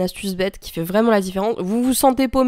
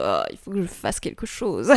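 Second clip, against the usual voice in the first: weary sigh; 6 semitones lower, 7 dB quieter than usual. Retold or imitated. Weary chore-laden self-quote.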